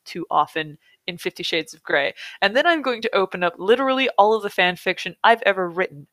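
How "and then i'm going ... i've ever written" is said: normal talking voice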